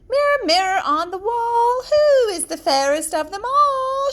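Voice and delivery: high, loud queenly voice to the mirror; the proud queen, commanding her mirror; haughty queen calling on the mirror, high and ringing; vain queen. Acted. Queen questions her magic mirror.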